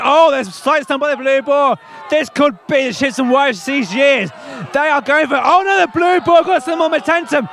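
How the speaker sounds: sports commentary building in excitement; live play-by-play. Real, not acted. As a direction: call the contest, climbing in pitch as it starts. Live tug of war commentary.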